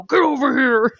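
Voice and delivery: dad's battle-cry voice, breaking into laughter; playful mock fighter's command, ending in a laugh; Scorpion. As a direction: imitate dad doing a game fighter's catchphrase. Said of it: "he would do, like, the Scorpion voice and go".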